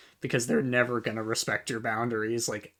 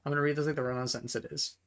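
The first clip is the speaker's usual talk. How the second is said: fast, softer, all in one breath; rushed run-on; 5 dB quieter, much faster than usual. Shown as a demonstration. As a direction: rattle it off like a run-on.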